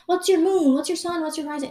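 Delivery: hammed-up eager astrology fan; mock eager questioner. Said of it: "I don't go and ask people".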